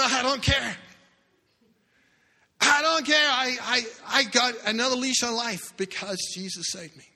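high, loud joyful defiance; loud, high, defiant proclamation. Retold or imitated. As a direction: shout it with no fear. Man defiantly proclaiming his faith.

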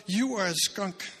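slow, high, loud scornful jab; mock condemnation. Imagined accuser insulting the woman, scornful. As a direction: sneer it like a judge.

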